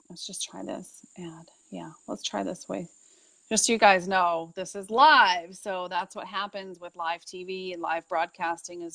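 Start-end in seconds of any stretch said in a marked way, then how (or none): 0.1-2.9 hushed off-mic whisper; whispered aside. Real, not acted. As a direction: drop to a whisper to yourself. Host muttering aside while fixing stream.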